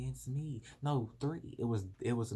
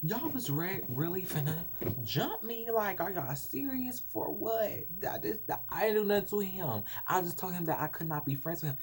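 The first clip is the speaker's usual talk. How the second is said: outraged disbelief; 7 semitones higher than usual. Retold or imitated. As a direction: hit them with hurt, outraged disbelief. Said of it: "And I'm like".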